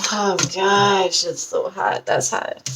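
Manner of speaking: ordinary delivery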